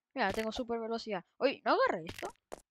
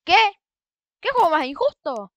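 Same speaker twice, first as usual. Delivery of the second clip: outraged; 4 semitones higher, 11 dB louder than usual. Real, not acted. Outraged at unfair game.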